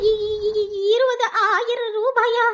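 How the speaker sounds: slow stammering gasp at the price; mother stunned by the phone bill, stammering; stammering shock. Acted. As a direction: choke on the number in disbelief.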